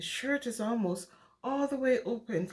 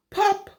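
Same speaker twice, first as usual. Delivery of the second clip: gasp; 7 semitones higher, 8 dB louder than usual. Acted. Last button pops.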